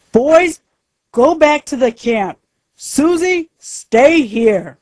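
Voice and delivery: put-on high, loud call to the children. Father calls out in forest.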